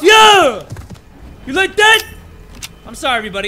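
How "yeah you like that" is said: high, loud gloating yell; gloating shout. Real, not acted. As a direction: gloat over the hit. Hitting enemy, gloating.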